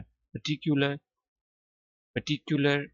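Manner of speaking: पर्टिकुलर with a clear "kyu" in the middle; teacher demonstrating पर्टिकुलर with the y-glide before u; corrected "kyu" pronunciation. Shown as a demonstration. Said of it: "इसको क्यू ही पढ़ना है".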